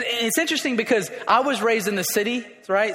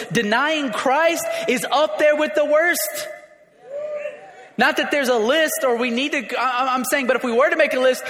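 high, loud preaching build; full-force preaching; 7 semitones higher, 4 dB louder than usual. Acted. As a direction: preach it hard to the congregation.

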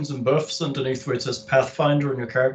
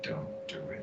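breathy whispered warning, fading out; 15 dB quieter than usual. Pretend. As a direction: let the warning die into a whisper. Third repeat, faded to a whisper.

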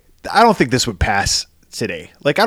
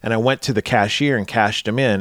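normal talking voice

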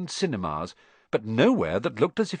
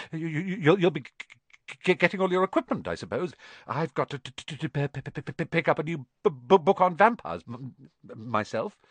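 nervous stammer; 4 semitones higher than usual. Acted. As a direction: trip over the P in fright.

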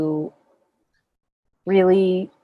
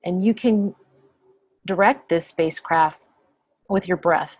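calm meditation guide, long pauses between phrases; unhurried, settled voice leaving lots of silence; guided meditation. Acted. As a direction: guide them gently inward, phrase by phrase.

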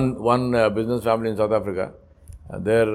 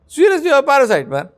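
much higher, louder and fast rebuke; scolding; 13 semitones higher, 5 dB louder, faster than usual. Retold or imitated. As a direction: hit him with it, exasperated. Scolding the rich son.

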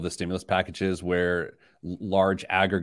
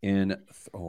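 normal talking voice

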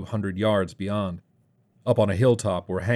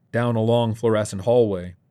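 ordinary delivery

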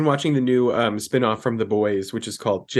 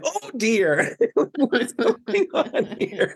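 higher, laughing prim shock; scandalized laughing; 11 semitones higher, much slower than usual. Retold or imitated. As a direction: gasp through laughter in mock alarm. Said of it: "we were all like".

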